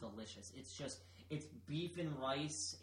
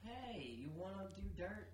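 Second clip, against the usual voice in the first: shady whisper-pitch voice; 6 semitones higher, 5 dB quieter than usual. Pretend.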